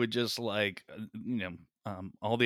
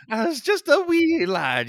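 put-on Irish accent; 13 semitones higher, 6 dB louder than usual. Joking about tormenting Irish folk.

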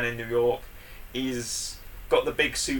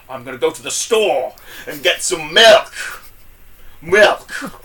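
much louder, higher Kingpin voice with milk punched out; Kingpin impression; 3 semitones higher, 10 dB louder, slower than usual. Shown as a demonstration. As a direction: suddenly blast the last words like Kingpin. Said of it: "So this is how Kingpin would say it".

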